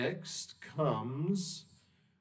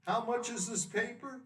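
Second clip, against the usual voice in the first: customer voice; 11 semitones higher, 3 dB quieter than usual. Acted. Customer asks newsboy.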